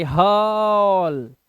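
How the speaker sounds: हॉल drawn out very slowly; very slow model word. Shown as a demonstration. Teacher stretching हॉल slowly as an example. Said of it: "एयू से बना ओ".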